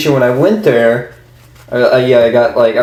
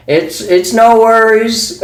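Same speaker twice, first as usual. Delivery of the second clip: slow, higher mock country drawl; imitating an old man's rural drawl, drawn out; a hint of a slow old country man; 10 semitones higher, slower than usual. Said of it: "the old guy behind me is like".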